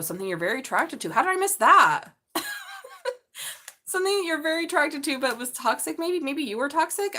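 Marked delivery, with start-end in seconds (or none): 1.1-2.1 high, loud startled exclaim; surprised. Real, not acted. Sudden surprise at the cards. 2.3-3.8 high squealing laugh. Real, not acted. Laugh after surprise. 3.9-7.2 excited. Real, not acted. Restating with excitement.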